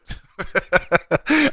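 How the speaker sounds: loud, high real laugh; burst of laughter. Real, not acted. Host tickled by his own answer, laughing loudly. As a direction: crack up out loud.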